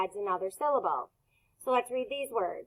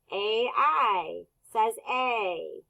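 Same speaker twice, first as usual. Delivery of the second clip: slow spelled out; much slower than usual. Shown as a demonstration. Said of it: "teacher spelling out sounds".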